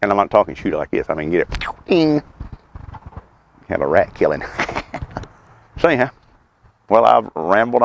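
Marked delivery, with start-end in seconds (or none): 1.9-2.2 ding sound effect. Pretend. Imitating the slingshot hit.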